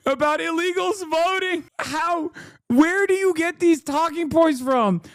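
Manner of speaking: can't believe what he's hearing; stunned disbelief. Real, not acted. Stunned by illegals voting claim.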